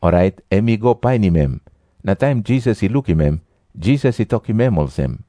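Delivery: usual speaking voice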